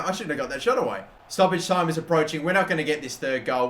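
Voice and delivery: ordinary talk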